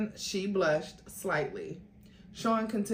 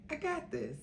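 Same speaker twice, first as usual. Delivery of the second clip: smiling reassurance; 9 semitones higher, 8 dB quieter than usual. Acted. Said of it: "as he told her with a smile".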